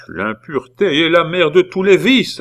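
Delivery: high, loud moralising pronouncement; sententious. A narrator's reading. Aunt cutting off her nephew with a moral, sententious. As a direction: deliver it like a pious maxim. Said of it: "interrompit sentencieusement mademoiselle Angélique".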